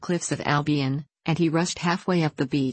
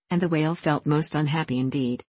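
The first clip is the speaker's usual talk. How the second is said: ordinary delivery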